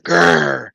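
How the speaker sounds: drawn-out "grr"; vampire growl. Retold or imitated. Chained vampire to Spidey, imitated growl.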